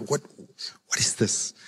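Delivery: lightly voiced puzzled diner, hushed; soft, mock-bewildered aside; baffled under-breath query.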